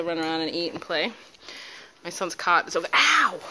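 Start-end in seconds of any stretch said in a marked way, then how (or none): 2.9-3.4 loud high yelp of pain; sharp ow. Real, not acted. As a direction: yelp as a puppy nips. Puppy bites her toe.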